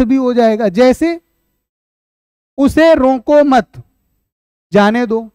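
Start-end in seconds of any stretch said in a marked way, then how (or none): 2.6-5.3 उसे रोको मत said slowly as one phrase, pause, then जाने दो; pause after मत. Shown as a demonstration. Teacher shows class how punctuation changes meaning, pause after मत. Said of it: "अगर हम यहाँ पर विराम चिन्ह".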